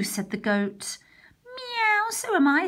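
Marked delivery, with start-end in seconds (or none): 1.4-2.7 much higher mewing meow; meowing cat. Acted. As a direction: purr out a cat's meow then agree. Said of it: "said the cat".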